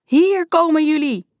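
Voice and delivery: very high, drawn-out yell; shrieked command. A narrator's reading. Lady in yellow suit chases the kids, shrieking. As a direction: screech it after someone, slowly. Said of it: "gilde de dame erachteraan".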